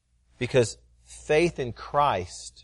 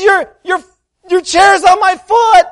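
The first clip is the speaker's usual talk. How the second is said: put-on high, loud little-boy voice; a five-year-old whose foot is crushed; small boy complaining; 14 dB louder than usual. Son telling his father about the chair, high child voice. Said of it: "And he says".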